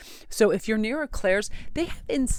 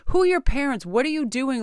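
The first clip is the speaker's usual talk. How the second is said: higher, louder exasperated scold; mock scolding; 7 semitones higher, 7 dB louder than usual. Scolding fake-talking teens in her imagination, high and loud. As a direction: scold them like a fed-up mom.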